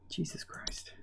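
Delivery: muttered under the breath; whispering. Real, not acted. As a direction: whisper it in disbelief.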